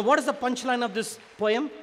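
ordinary talk, nothing marked

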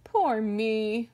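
loud wail of self-pity; hammed-up pitiful bear cry. A narrator's reading. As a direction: wail it out, sorry for yourself. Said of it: "cried the bear".

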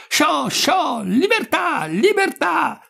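very high, drawn-out jubilant cries; shouting. A narrator's reading. Two men shouting through the emptied rooms, high cries. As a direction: keep crying out to shoo the birds away. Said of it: "rispondevano seguitando a gridare".

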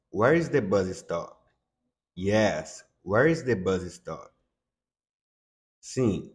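ordinary talk, nothing marked

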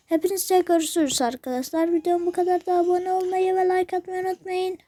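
cheerful outro announcement; YouTuber outro. Acted. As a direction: wrap up for the viewers. Video outro.

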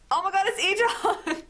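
high-pitched excited squeal; real squeal at the reveal; thrilled surprise. Real, not acted. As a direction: blurt it out high, breaking into laughter. Reveal of the hoped-for last card.

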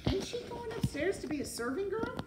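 put-on high, loud girl's question; serving-girl voice. A narrator's reading. Mig questioning the rat about the plan, high-voiced. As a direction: pipe up high as the confused girl.

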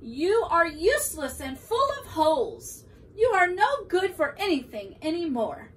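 cross storybook outburst; angry yelling. A narrator's reading. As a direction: tell it off loudly, pitch climbing. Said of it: "began to yell at his hat".

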